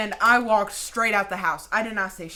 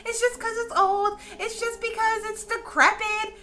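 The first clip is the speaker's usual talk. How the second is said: much higher, teasing sibling voice; mocking siblings; 3 dB louder than usual. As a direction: whine it up high like a dismissive sibling. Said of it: "none of my siblings believed me".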